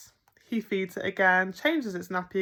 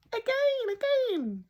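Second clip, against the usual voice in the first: put-on much higher little child voice; baby shouting; slower than usual. A narrator's reading. Baby cheering after Bill falls, squealing. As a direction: squeal it like a delighted toddler. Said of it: "The baby laughs and shouts".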